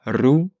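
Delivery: "Ru" with a trilled r; rolled r. Shown as a demonstration. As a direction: roll the r in "Ru". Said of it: "t'arrives à avoir ce rou".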